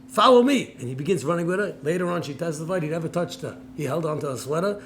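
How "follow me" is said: loud, higher, firm command; commanding order. Retold or imitated. Fighter pretends to take her hostage, loud order. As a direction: bark it like a captor.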